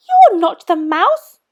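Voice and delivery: put-on high, loud young monster voice, questioning; a bold little monster asking; Gruffalo's child.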